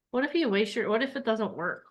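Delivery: normal talking voice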